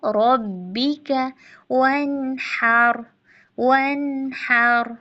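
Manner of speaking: firm ba in रब्बिका, slow वनहर; tajweed demo. Shown as a demonstration. Teacher recites वनहर slowly for students. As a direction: press the ba of रब्बिका, stretch वनहर. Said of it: "बा पर जमाओ".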